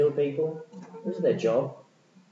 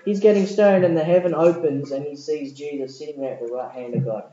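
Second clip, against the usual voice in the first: ordinary talk, nothing marked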